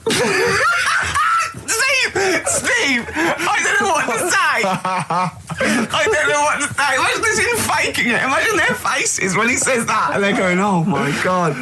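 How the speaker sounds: high, squealing words through laughter; helpless high-pitched laughing disbelief. Real, not acted. Laughing fit at cat food quote.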